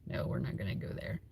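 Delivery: hushed, teasing whisper; whispered aside. Real, not acted. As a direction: drop to a whisper and wave it off. Refusing to discuss the election.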